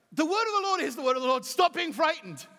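fast, loud insistence; fervent preaching shout. Acted. Preacher to congregation, rising fervent shout. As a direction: drive it home to the church.

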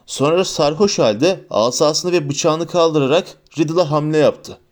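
plain reading voice

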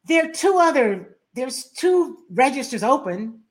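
high, loud imitation of the stranger yelling; retold yell. Stranger yells at the speaker in the store line, imitated loud and high. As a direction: mimic her sharp yell at a stranger. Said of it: "she yells".

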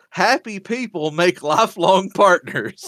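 high, slow, deliberate read-out; mom's earnest post. Retold or imitated. Son reading mom's anniversary post aloud, mock-earnest. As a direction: recite it slowly as mom's proud post.

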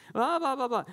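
very high, loud mock child whine; whining kids. Children protesting the bedroom lockout, very high mock whining. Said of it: "complaining and whining".

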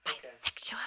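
unvoiced whispered aside. Real, not acted. Whispering a joke aside to co-host. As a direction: breathe the word out as a secret. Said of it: "whispered aside".

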